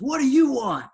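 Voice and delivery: high, loud yell at the door; gruff yell. Retold or imitated. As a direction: bark it at a stranger. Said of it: "he goes".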